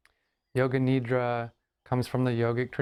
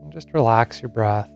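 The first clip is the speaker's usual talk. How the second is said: guided meditation; 10 dB louder than usual. Acted. Yoga teacher settles listeners into Yoga Nidra, guided meditation. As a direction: lead them gently into rest, phrase by unhurried phrase.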